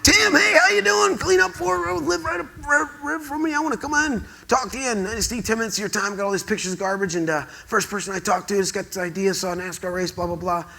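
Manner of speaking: high, fast, pumped-up pitch to the boss; overexcited phone pitch to a busy executive; eager sales pitch. Retold or imitated. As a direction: gush it out like a kid who finally got through. His excited pitch call to Wilkinson.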